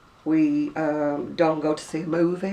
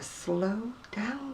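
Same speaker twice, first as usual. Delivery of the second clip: drawn-out, softer plea to slow down; telling friend to slow down; 5 semitones higher, 7 dB quieter than usual. Retold or imitated. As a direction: stretch the words out, higher and softer. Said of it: "I have to say to her".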